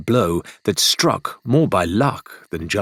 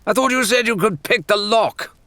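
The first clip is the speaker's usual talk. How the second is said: impatient Rathbone; 14 semitones higher than usual. A narrator's reading. Rathbone hurrying Shan at the cage lock, agitated high shout. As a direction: snap at him to hurry, pacing and on edge. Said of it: "He was pacing, agitated".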